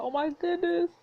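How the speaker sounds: high, excited oh my goodness; flustered squeal. Real, not acted. Reader flustered by the scene.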